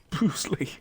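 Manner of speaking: whispered through a laugh. Real, not acted. Gamer whispering a fighter's name while laughing. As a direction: breathe the name with a giggle.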